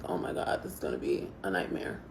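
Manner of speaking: whispering under breath; whispered dread. Retold or imitated. Dread at barking dog.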